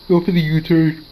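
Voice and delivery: talking through a yawn. Real, not acted. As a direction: say it mid-yawn. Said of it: "Excuse me for my yawning".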